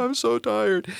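high, mock-weary whine; tired disciples. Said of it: "asking the disciples to stick up".

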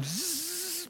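mock balloon losing air with a high zzz; deflating balloon hiss. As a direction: let the air leak out of the balloon.

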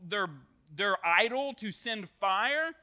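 ordinary talk

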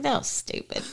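unvoiced, quiet judgement; whispered aside. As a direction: breathe it under your breath. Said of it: "I was like".

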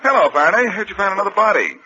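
high, loud, mock-cheerful hello; a brash, loud greeting to rile him; cocky teasing greeting. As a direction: needle the inspector with fake cheer. Detective needles the grumpy inspector.

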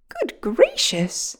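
slow, high gasp of surprise; startled little bird, drawn out; surprised sparrow. A narrator's reading. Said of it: "said the sparrow in surprise".

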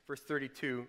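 ordinary delivery

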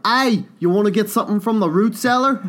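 mock Australian. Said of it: "gonna be like".

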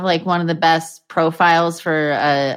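ordinary delivery

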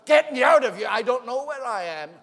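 very loud, high panicky yell; desperate child shout. Acted. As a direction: cry out like a trapped little boy. Lost child's plea, voiced by preacher.

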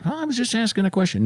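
high, quick, defensive protest; mock annoyed. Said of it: "you really sounded, uh, annoyed".

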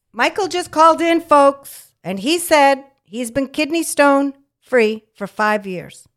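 loud, high, showman announcement to the folks; announcer-style call-out. Real, not acted. Host calling out the news to her listeners, loud and high. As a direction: boom it out like a radio host.

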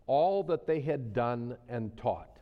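slow, weighing each word; slow deliberate reading. Real, not acted. Preacher reading scripture to his flock, slow and deliberate. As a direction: let each word sink in. Preacher reads the verse slowly.

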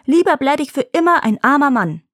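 high, loud frightened refusal; horrified cry. Acted. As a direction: cry it out, still appalled.

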